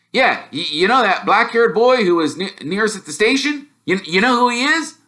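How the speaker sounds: high, tripping over his words; a kid too thrilled to get it out; excited stammering boy. Acted.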